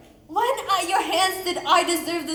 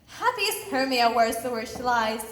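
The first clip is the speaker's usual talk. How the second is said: ordinary delivery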